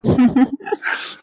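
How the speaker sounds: high, mostly voiceless giggle; amused laugh. Acted. Brother laughs at sister's chicken rice request, amused laugh. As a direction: break into a short, breath-led laugh.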